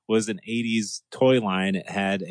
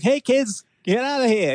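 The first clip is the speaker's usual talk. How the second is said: put-on Boston accent; 13 semitones higher than usual. Boston fireman shooing kids in a Boston accent. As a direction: shout it like a Boston firefighter from Boston. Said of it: "he should really sound like Bill Burr".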